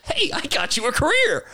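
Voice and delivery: hammed-up high-pitched celebrity impression; Joe Pesci. Imitating the star confronting his impersonator over a career, mock demanding. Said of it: "Joe Pesci's first thing he says".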